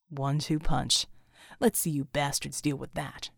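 low, satisfied soldier quip; low and pleased with the hit; gruff soldier voice. Acted. Soldier quip after a kill.

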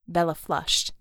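usual speaking voice

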